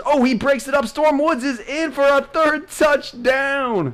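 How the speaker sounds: high, loud, slow announcer cry; excited touchdown call. Real, not acted. Calling a touchdown.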